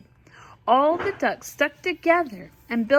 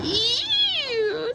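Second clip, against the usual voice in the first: much higher squeal of disgust; 14 semitones higher than usual. Acted. Mother reading, Emily squeals at the spiders. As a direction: squeal it out grossed out. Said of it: "said Emily".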